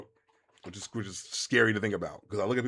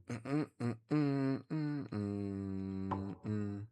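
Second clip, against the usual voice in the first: soft hummed mm-mm-mm; idle humming; 9 dB quieter, much slower than usual. Real, not acted. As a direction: hum quietly to yourself while browsing. Humming while looking things over.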